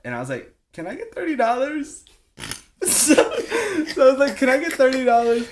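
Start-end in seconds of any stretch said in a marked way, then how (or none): 0.7-2.0 high, sweet begging voice; high wheedling plea to mom; pleading kid voice. Retold or imitated. 2.4-4.4 words broken by laughter; high laughing voice. Real, not acted. Laughing at own story. 4.4-5.4 high, louder pleading; high loud wheedling plea; begging again. Retold or imitated. Repeats plea, louder, laughing.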